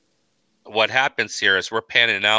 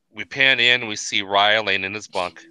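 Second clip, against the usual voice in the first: ordinary delivery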